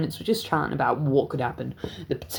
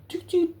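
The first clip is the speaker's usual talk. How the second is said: high beeping noise; imitating the body-worn camera switching on.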